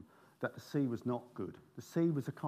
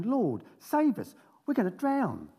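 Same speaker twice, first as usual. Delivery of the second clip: frightened plea; 9 semitones higher, 6 dB louder, slower than usual. Acted. Disciples cry out in the storm.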